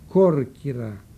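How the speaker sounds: normal talking voice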